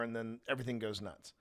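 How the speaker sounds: ordinary delivery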